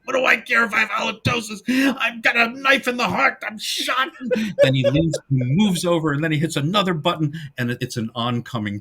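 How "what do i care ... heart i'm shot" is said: high-pitched, exasperated mock wail; indignant Lou. Lou protesting that he's been stabbed and shot, high and indignant. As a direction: whine it high and fast, building to a comic cry. Acting out Lou's panicked outrage.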